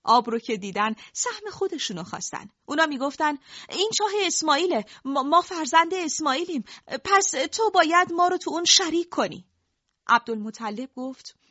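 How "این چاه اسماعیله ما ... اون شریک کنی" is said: demanding crowd. Acted.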